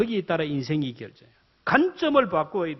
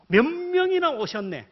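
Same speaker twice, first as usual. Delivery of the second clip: higher and slow, pleased and thankful; grateful delight; 8 semitones higher, 3 dB louder than usual. Shown as a demonstration. Pastor reframing the same turnout, pleasantly surprised. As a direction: say it like the room is full of blessings. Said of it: "대본 고칩니다".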